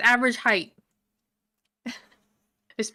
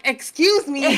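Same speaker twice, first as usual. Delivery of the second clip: louder, higher, laughing offence; playfully indignant excuse me; offended excuse me; 5 semitones higher, 9 dB louder than usual. Retold or imitated. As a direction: take mock offence. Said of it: "I'm like".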